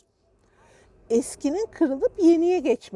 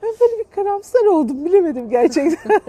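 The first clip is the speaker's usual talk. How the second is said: louder, laughter still in voice; after laughing hard; 5 semitones higher, 6 dB louder than usual. Real, not acted. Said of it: "dolu dolu gülelim demişim". (kahkahadan sonra gülerek)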